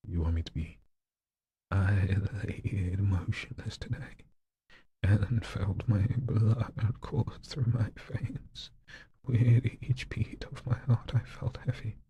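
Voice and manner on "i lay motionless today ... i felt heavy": slow, higher, wavering read; a slow shaky higher reading of grief; slow with a tremble. Shown as a demonstration. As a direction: let the voice tremble slowly upward. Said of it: "Like that".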